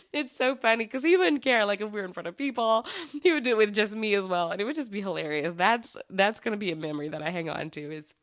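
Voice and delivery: ordinary talk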